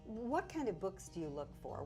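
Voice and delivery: ordinary talk, nothing marked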